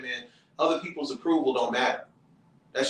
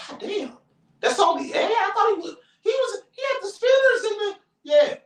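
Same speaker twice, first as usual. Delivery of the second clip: shocked disbelief; 3 dB louder than usual. Retold or imitated. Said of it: "It's like".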